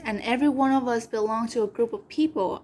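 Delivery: normal talking voice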